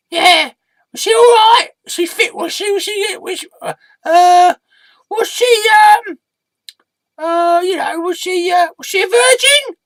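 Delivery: very high voice, stumbling towards a rude question; nosy squeaky alter ego. Acted. Prying sidekick asks about his date, high-pitched and halting. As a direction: build from hesitant squeaks to a blurted question.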